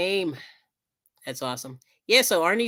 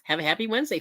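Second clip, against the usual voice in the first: ordinary delivery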